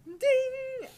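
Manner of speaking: high, loud ringing ding; imitated triangle ding. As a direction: sing out a triangle chime. Imitating a triangle in planned band.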